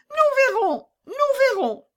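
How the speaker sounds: put-on very high, loud child voice; teary robber girl. A narrator's reading. Robber girl wiping tears after Gerda's story, very high child voice. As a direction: fight back tears and stay tough. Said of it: "essuya des larmes qui coulaient de ses yeux".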